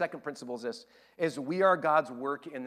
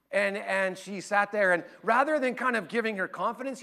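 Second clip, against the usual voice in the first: ordinary delivery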